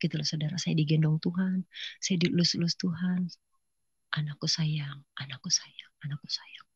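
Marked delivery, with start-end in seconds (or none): 4.2-6.7 very soft, low loving murmur; tender whisper. Retold or imitated. God stroking His child, tender whisper. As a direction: whisper it like soothing a cradled child.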